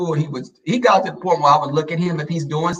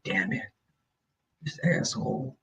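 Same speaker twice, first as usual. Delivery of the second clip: quiet inner grumble; muttered thought; 11 dB quieter than usual. Retold or imitated. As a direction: grumble it privately under the breath. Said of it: "thinking like that".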